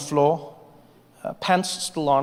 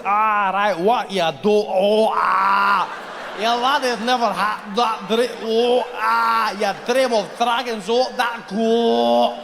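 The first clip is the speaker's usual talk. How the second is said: put-on high, slow Scottish accent fighting back vomit; slurred Scottish with retching vowels stretched out; Scottish accent, queasy; 6 semitones higher, much slower than usual. Drunk Scots trying not to throw up, Scottish accent. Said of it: "centuries of people trying not to throw up".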